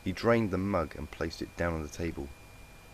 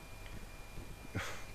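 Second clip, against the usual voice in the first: heavy unvoiced sigh; weary sigh; 13 dB quieter than usual. A narrator's reading. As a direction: sigh in defeat. Sorin sighs in despair.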